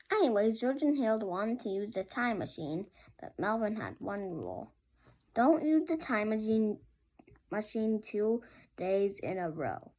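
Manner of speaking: plain reading voice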